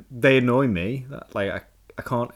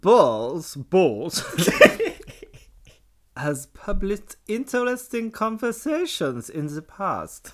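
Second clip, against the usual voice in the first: mock annoyed German reading; annoyed German accent; 8 semitones higher, 7 dB louder than usual. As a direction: read it like an irritated German. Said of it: "Can you read out as a German? An annoyed German".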